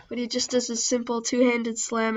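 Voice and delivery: ordinary talk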